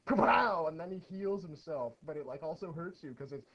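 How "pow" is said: loud shouted pow; pow sound effect. Pretend. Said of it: "it goes".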